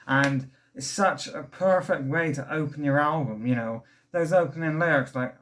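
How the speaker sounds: normal talking voice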